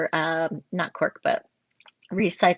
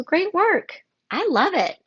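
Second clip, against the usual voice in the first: higher and louder, bursting with enthusiasm; excited praise; 11 semitones higher, 5 dB louder than usual. Real, not acted. Teacher wrapping up a student's critique, excited praise. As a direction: cheer the student's work like a thrilled teacher.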